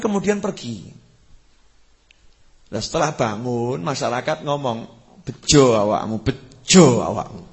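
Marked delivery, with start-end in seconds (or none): 5.2-7.5 loud, slowed calling out; villagers calling out. Retold or imitated. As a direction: call out Bejo awakmu to the man. Villagers call out to the drunk.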